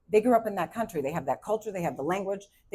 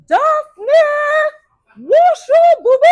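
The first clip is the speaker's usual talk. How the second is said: mother yelling; 16 dB louder than usual. Retold or imitated. Mom calling her daughter in from hide and seek, yelling. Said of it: "was yelling".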